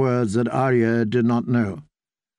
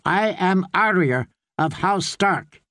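higher, defiant declaration of her name; thrown at him; 6 semitones higher than usual. A narrator's reading. Girl flinging her true name at the priest, defiant. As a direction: hurl the name at him like a challenge. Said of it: "she threw it at him".

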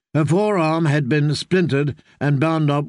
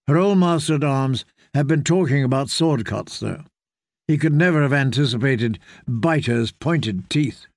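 ordinary talk, nothing marked

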